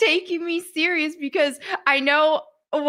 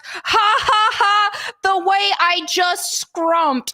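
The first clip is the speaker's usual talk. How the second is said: high, slow, hammed-up all-caps laugh; mock TikToker; 5 semitones higher, 3 dB louder, slower than usual. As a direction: imitate an overexcited TikTok commenter. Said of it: "trying to talk like a TikToker".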